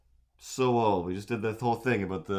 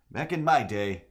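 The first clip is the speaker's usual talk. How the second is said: put-on a touch higher crotchety old-timer, same again; old man voice; 4 semitones higher, 4 dB louder than usual. Streamer repeats the old man line, slightly raised. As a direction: grumble it like a grandpa once more. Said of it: "trying to do that old man voice".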